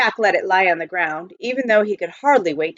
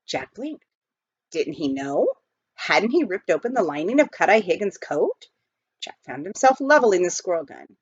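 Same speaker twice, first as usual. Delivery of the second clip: usual speaking voice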